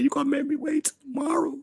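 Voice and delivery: high put-on whining voice; mock whine. Whining to his waitress about waiting, high and pleading. Complaining the waitress made him wait.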